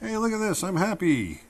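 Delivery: high, gleeful show-off voice; happy showing off. Acted. Teacher voicing a happy drawn figure, high gleeful call. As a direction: show everyone how happy you are. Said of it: "Jumping up in the air".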